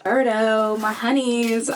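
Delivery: usual speaking voice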